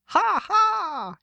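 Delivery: high-pitched spoken "ha, ha"; acted laugh. A narrator's reading. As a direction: act out a high, drawn-out laugh. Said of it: "So he began to laugh too".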